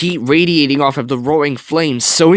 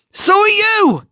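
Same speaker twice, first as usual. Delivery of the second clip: much higher, sharp retort; snapping; 4 dB louder, slower than usual. A narrator's reading. Aunt Cass snaps at her nephew who insists on going, sharp and high. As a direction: snap back at him in fear. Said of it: "Cass snapped".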